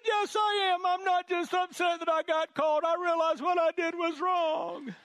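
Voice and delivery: put-on a little girl insisting, nearly in tears; imitating insistent daughter. Father acting out daughter insisting she truly understands, high and loud. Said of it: "She said".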